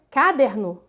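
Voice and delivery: "caderno" wrongly stressed on the first syllable, CA-derno; shifted stress. Shown as a demonstration. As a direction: hit CA in "caderno", leaving DER unstressed. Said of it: "Eu vou deslocar a sílaba tônica". ("caderno" com a tônica deslocada para a primeira sílaba: CÁ-derno)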